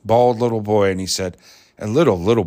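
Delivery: ordinary talk, nothing marked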